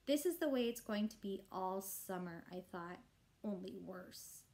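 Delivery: ordinary delivery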